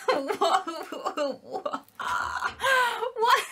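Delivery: high-pitched, astonished outburst; can't believe what came out of the box; shocked disbelief. Real, not acted. Vlogger seeing the first item out of the box, shocked.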